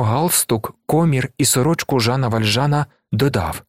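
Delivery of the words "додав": ordinary delivery, nothing marked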